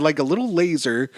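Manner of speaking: normal talking voice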